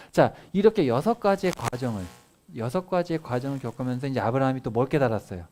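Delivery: normal talking voice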